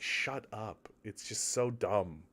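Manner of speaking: ordinary talk, nothing marked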